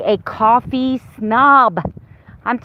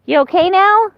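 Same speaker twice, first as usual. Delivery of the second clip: high, sweet pet voice, much higher; 10 semitones higher, 4 dB louder than usual. Real, not acted. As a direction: coo at the puppy in a high voice. Talking to her puppy.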